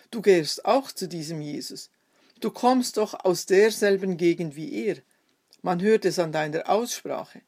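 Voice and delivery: ordinary delivery, nothing marked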